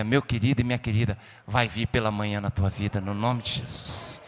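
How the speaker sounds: low, soft, tender pastoral voice; soft intimate preaching. Acted. Preacher consoling grieving listeners, soft and low.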